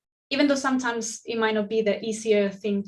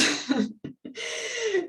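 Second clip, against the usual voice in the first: high-pitched real giggle; amused laugh; 12 semitones higher, 4 dB quieter than usual. Real, not acted. Laughs at own contradiction.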